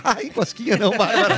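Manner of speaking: being tickled. Retold or imitated. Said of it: "Quando ele diz".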